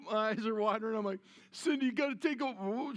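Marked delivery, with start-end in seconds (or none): 1.5-2.9 high, strained plea to his wife; about to lose it, begging for help; gagging panic. Retold or imitated.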